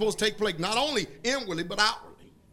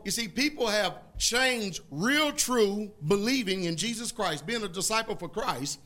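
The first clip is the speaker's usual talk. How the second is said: normal talking voice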